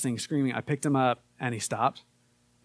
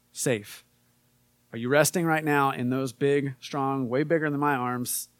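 normal talking voice

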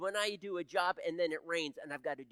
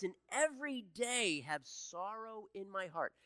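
scripture reading. Acted. Pastor reading Psalm thirteen to the church, earnest. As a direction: read the lament like a prayer.

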